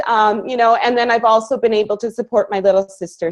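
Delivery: ordinary delivery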